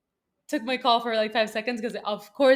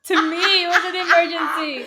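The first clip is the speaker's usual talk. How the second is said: nervous laugh; 6 semitones higher, 9 dB louder than usual. Real, not acted. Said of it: "this is a nervous laugh".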